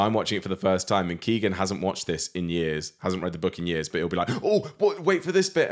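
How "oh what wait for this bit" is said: high-pitched mock eagerness; imitated excited interruption. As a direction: imitate an eager fan butting in. Imitating partner's eager interruption while watching.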